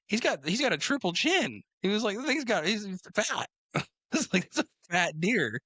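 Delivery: a hammed-up dad, pitched high and amazed; dad teasing about the deer's triple chin; high mock dad voice. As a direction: lightly mimic dad laughing at the fat deer. Dad voiced in retold story.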